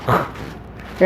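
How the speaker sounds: soft, breath-only "흐" from the throat; guttural "흐". Shown as a demonstration. Teacher demonstrating the throat sound of chi, voiceless "흐". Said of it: "예, 후음이에요".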